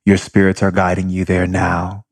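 ordinary talk

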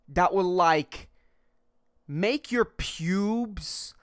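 normal talking voice

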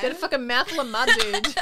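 laughing, pitching up at the end; a laugh-filled jokey command, rising high on dude; laughing mock order. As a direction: giggle through a mock director's order.

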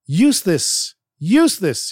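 shouting in disgust. Acted. Baker shouts at his useless son-in-law in disgust. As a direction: spit the word out at him. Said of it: "he starts shouting at his son-in-law".

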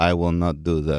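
low, measured refusal; refusing cab driver. Retold or imitated. Imitating the driver turning down a bet, stiff. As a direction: drop the voice low and decline stiffly. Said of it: "He's like".

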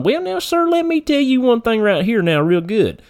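very high-pitched backwoods Kentucky salesman. Acted. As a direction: squeal out a hammed-up hillbilly sales pitch. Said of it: "you sound like this right here".

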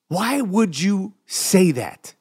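WHY WOULD YOU SAY THAT stretched slowly, word by word; slow, deliberate questioning; slow, pointed repeat. Real, not acted. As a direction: drag out the challenge at half speed. Repeats challenge slowly for emphasis.

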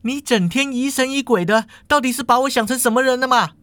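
high, indignant woman's voice; high-pitched lovers' quarrel; quarrelling woman. A narrator's reading. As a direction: protest to a jealous boyfriend. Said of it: "听见女人的声音啦".

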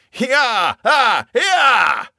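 piercing high shrieks of terror; terrified shriek. Acted. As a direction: scream as if seeing the thing rise from the sea. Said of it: "the old man's shriek".

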